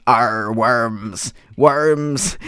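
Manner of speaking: drawn-out pirate "arr"; hammed-up pirate voice. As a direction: growl it out like a pirate. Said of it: "It's like a pirate".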